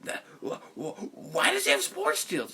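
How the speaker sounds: sputter, then burst out high and loud; stammering build into a mock shriek; Nostalgia Critic. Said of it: "Nostalgia Critic impression".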